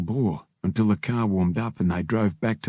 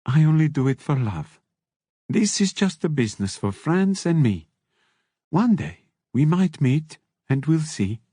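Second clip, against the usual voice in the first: higher-pitched character voice with careful phrasing; lightly accented character; 6 semitones higher than usual. A narrator's reading. Explaining the business to a visitor, higher character voice. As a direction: play the character in a higher register.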